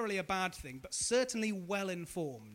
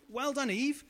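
usual speaking voice

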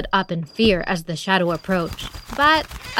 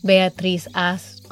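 usual speaking voice

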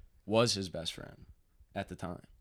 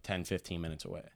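ordinary delivery

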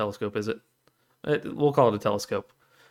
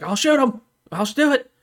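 high-pitched hostage-taker bluff; mock desperate threat; 13 semitones higher, 4 dB louder than usual. Sheriff bluffing the crowd as hostage-taker, mock threat.

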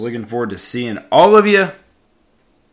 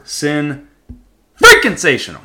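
yelled; 3 semitones higher, 5 dB louder than usual. Real, not acted. Yelling the word to his listeners, loud yell. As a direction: break the word and shout the middle. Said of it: "Sorry I yelled".

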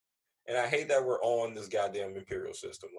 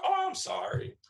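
light high woman's apology; a polite lady stepping back, high; lady apologizing. Retold or imitated. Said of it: "She's like".

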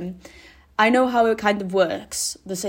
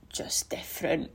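whisper; 2 semitones lower, 8 dB quieter than usual. Real, not acted. Aside confessing she's different.

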